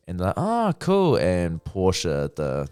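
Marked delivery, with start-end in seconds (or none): 0.4-1.2 high, louder mock-impressed reaction. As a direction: imitate an easily convinced group, pitched up. Retold group's reply to introduction.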